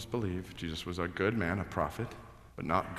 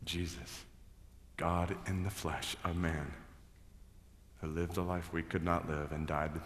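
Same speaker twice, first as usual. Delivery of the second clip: scripture reading; 5 dB quieter than usual. Acted. Pastor reads Hebrews to the congregation, soft and reverent. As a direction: read the passage gently and reverently.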